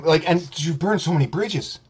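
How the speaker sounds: ordinary talk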